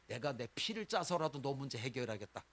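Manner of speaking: usual speaking voice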